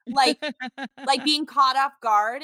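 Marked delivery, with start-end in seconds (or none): none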